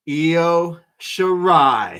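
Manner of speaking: loud, very drawn-out name; high, stretched hype call; ring announcer reveal. Acted. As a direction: boom it out slowly like a big entrance. Announces the surprise wrestler.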